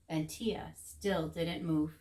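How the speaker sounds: slow, soft, low narration; slow, low stillness. A narrator's reading. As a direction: draw it out to show stillness. Repeat of stillness.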